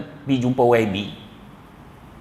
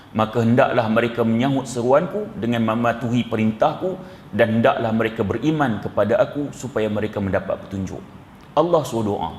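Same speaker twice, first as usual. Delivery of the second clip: normal talking voice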